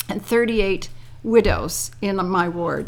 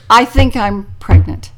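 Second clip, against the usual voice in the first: louder, for deaf ears; loud mock confession; 9 dB louder than usual. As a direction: announce it loudly for the deaf ladies. Loud joke announcement to ladies.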